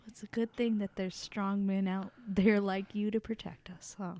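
high whispered flirting; breathy flirty whisper. Acted. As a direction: purr it like a flirt. Player character flirts.

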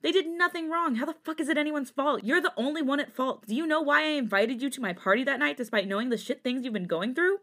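heated arguing. A narrator's reading. Sungmin defends the exes to Jisung, indignant. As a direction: press each accusation insistently.

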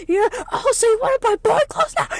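hammed-up scolding shriek; imitating angry yell. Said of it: "you started yelling".

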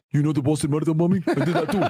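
quick, lightly accented mock confession; tossing off a confession in a put-on Russian accent. Streamer mocking the bomber's confession in a light Russian accent. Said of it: "Tamerlan speaks with an accent too".

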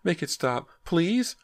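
high, desperate cry of please; terrified pleading. A narrator's reading. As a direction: beg in panic, pitch soaring. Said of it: "her eyes wide with fear".

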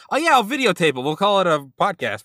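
high, loud mock breezy shrug; mock casual. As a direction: toss it off like it's no big deal. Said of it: "And it's just".

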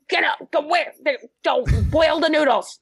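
high, hammed-up shout; mock shout. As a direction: shout a silly order at yourself. Joking yelled inner monologue.

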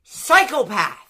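high, loud indignant rant; mock outrage. Eater berating the brother who spoiled the veal, mock outrage. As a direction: exclaim it in comic disbelief. Ranting at brother's cruel reveal.

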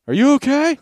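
put-on high, loud worried girl; mock worried girl filming. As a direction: crowd into his face, worried and shrill-high. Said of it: "the girl recording just gets right up in his face with the camera and goes".

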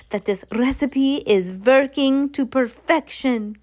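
put-on slow, grand witch accent with rolled Rs; slow villainous witch accent with rolled Rs. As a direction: roll every R like the Grand High Witch's accent. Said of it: "I'm not gonna roll my R's every time".

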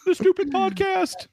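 high, slow whiny mock lament; hammed-up sulky whine. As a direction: whimper it like a sulking kid. Joking self-pity about the podcast.

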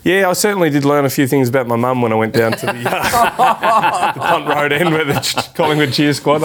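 laughing through the story about mum; chuckling as he remembers his mum. Real, not acted. Remembering mum at the Collingwood end, laughing.

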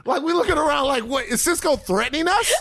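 high, incredulous questioning; comic disbelief shooting up in pitch; mock bewilderment. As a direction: act out being totally baffled by a silly threat. Re-enacts bafflement at the threat.

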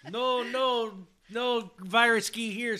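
put-on loud, slow joking Russian accent with a laugh; mock Russian accent. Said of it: "I don't think that was a Russian accent".

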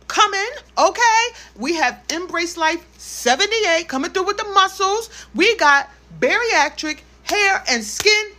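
high, loud, excited event ad; loud, high hype-girl announcement, stretching the big names; hype promo read. Acted. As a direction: sell the meetup like a hyped-up host. Hosted promo for her virtual meetup.

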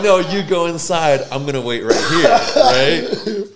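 high, loud mock of the son through laughter; cracking up as the son stays in the car; laughing quote. Real, not acted.

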